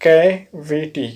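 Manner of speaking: कैविटी said very slowly, syllable by syllable as ka-vi-ti; careful pronunciation. Shown as a demonstration. Teacher to students, pronouncing the new word very slowly. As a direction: stretch out कैविटी slowly so students can copy each sound. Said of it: "इसका pronunciation है".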